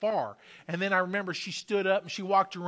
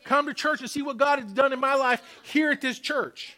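raised, higher-pitched sermon voice; fervent preaching; 6 semitones higher, 5 dB louder than usual. Real, not acted. Preacher urging congregation to testify of miracles, fervent preaching. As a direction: drive it home to the congregation with force.

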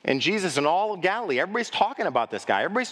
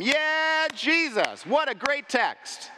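high, delighted approval; impressed crowd; 11 semitones higher, much slower than usual. Retold or imitated. Synagogue listeners praising Jesus, high and delighted. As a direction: gush over the reading like a fan.

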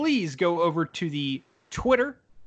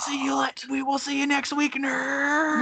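high hushed mock shout; whisper scream; 8 semitones higher than usual. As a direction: yell it without waking anyone. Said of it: "in the quietest voices we can muster".

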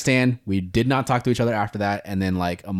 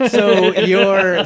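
laughing while talking; 9 semitones higher, 4 dB louder, much slower than usual. Real, not acted. Laughing while fumbling names.

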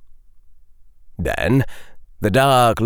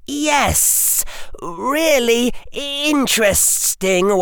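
put-on high, tense pleading boy; earnest boy voice; 13 semitones higher than usual. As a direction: bargain desperately with the villain. Harry bargains with Dark Lord.

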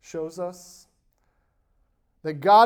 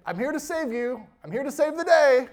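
mock hero; 8 semitones higher, 3 dB louder than usual. Mock heroic Jesus.

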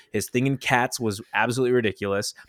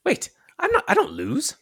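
mock protest. Acting out the archery scene.

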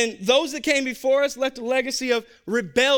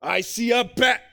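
building to a shout, in a bad Jamaican accent; slow and swelling, a shouted Jamaican pep talk; 4 semitones higher, 6 dB louder than usual. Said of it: "No, it's terrible".

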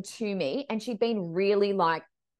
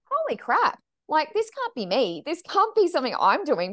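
alarmed thought; 6 semitones higher, 3 dB louder than usual. Retold or imitated. Inner panic mid-reading.

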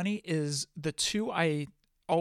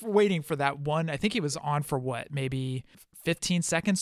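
ordinary talk

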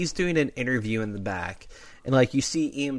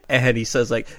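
normal talking voice

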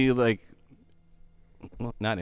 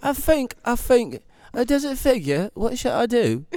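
high, light British accent impression; 12 semitones higher, 5 dB louder than usual. Imitating a British comedian friend, high British accent. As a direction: put on a posh-ish British accent. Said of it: "That was my British accent".

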